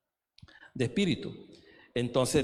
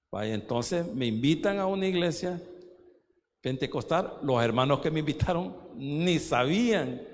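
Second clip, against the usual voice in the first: usual speaking voice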